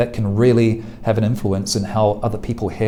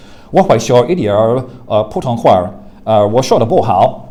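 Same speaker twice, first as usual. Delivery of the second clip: Beijing Mandarin, a touch louder, from the back of the throat; strong Beijing Mandarin, placed far back; Beijing accent; 6 dB louder than usual. Shown as a demonstration. Teacher demonstrating a Beijing accent, a touch louder. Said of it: "I will do Beijing accent".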